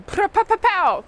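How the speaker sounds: spoken gunfire sound effect; imitated gunshots. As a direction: voice a quick string of mock shots.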